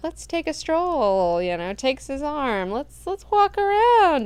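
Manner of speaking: high, slow, sweet-talking voice; coy Siona. Retold or imitated. Siona coaxes Duncan into a stroll, high and slow.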